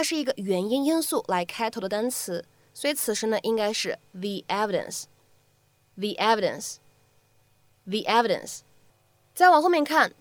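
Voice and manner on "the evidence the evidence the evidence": "the evidence" with "the" said thee before the vowel. Shown as a demonstration. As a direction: say thee in "the evidence", slowly repeated. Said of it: "定冠词the为什么要读成the".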